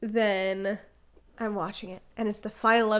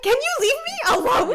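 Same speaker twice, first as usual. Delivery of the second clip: exasperated; 5 dB louder than usual. Retold or imitated. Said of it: "And I'm like".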